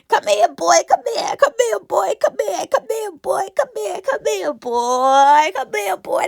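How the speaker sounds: high-pitched, repeated begging; squeaky begging come here; high pleading call. Pretend. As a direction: coax him over, voice way up. Imagined pleading call to Dawn.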